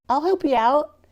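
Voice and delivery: high, loud rustic voice; a hint of a toothless hillbilly offering help; hillbilly helper.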